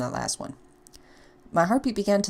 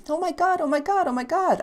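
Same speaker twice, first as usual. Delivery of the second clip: higher, giddy repeated oh my god; excited gushing; 11 semitones higher than usual. Acted. Ashley thrilled the tracker may save her, excited. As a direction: squeal with relief.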